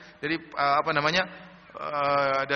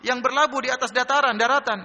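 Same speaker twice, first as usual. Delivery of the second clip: raised-pitch, scoffing question; mocking question; 6 semitones higher, 3 dB louder than usual. Passers-by ridicule Noah about a ship on dry land, mocking. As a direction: imitate a heckler ridiculing with a pointed question. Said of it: "Ada lagi ngejek".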